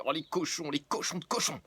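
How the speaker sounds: high, loud outraged wail; shrill comic fury at the culprits; outraged cry. Acted.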